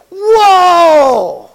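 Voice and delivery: loud, high amazed gasp of wonder; amazed whoa. Retold or imitated. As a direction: cry out in astonishment. Disciples' amazed reaction acted out.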